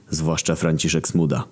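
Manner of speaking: ordinary delivery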